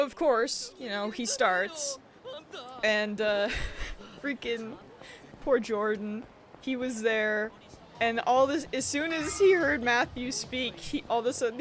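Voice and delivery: usual speaking voice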